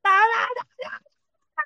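put-on very high, loud, drawn-out toddler squeal; imitating shouting toddler. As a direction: mimic a small child yelling one syllable over and over. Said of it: "เด็กตะโกนว่า". (เลียนเสียงเด็กเล็กตะโกนแหลมสูงลากยาว)

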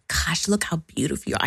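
fast, doting whisper. Retold or imitated. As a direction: whisper it like cooing to a baby. Cooing to her little children.

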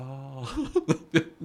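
loud high burst of laughter. Real, not acted. Laugh heard.

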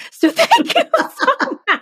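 high, laughing thank-you, choked with emotion; tearful laughing thanks. Real, not acted. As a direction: laugh through the thanks, moved to tears. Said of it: "it really made me cry".

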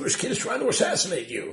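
high, loud cry of alarm; urgent alarm. Retold or imitated. Policeman warns the king of an attacker, urgent and loud.

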